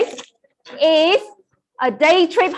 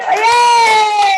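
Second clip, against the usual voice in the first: excited cheer; 5 semitones higher than usual. Real, not acted. Cheering a winner.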